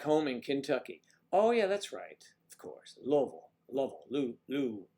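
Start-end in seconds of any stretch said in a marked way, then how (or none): 3.0-4.0 "Louisville" squeezed to two syllables, LUH-vul; "Louisville" as locals swallow it, LUH-vul; local pronunciation. Shown as a demonstration. Tries local way of saying Louisville.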